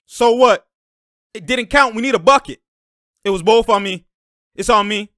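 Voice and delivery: acted on-court shouting; owning up loudly. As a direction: holler it to the teammates. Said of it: "he's letting them know both plays was on me".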